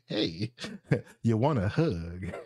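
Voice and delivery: giggling through the words; teasing laugh, slow. Real, not acted.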